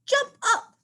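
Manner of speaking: high squeaky command; high mouse urging a jump. Acted. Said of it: "Marvin squeaks".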